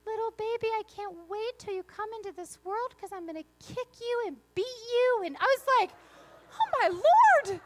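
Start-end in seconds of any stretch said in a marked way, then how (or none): 0.0-5.2 put-on higher, sugary little-boy voice; sweet little toddler voice. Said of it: "with the sweetest little voice". 6.5-7.6 much higher and louder, slowed mock horror; shocked exclamation. Mom shocked by son's words.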